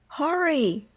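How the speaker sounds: high, loud urgent call; excited high shout. A narrator's reading. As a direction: push your friend to run now. Frog urges chase after list.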